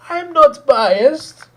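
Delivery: very high, loud, drawn-out mimicry; hammed-up imitation of an indignant protest; mocking denial. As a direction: shriek it slowly in a put-on voice. Mimicking the same denial again.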